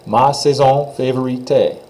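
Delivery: Ma saison favorite, T run into the final sound; teacher demo; French pronunciation. Shown as a demonstration. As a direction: model favorite with te as one sound. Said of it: "One more time".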